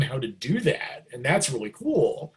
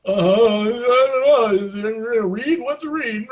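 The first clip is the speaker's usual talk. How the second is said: high, hammed-up panic; mock flustered squeal, drawn out at the start; freaking out; 9 semitones higher, 5 dB louder than usual. Starstruck kid questioned by his sax idol, freaking out. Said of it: "freaking out".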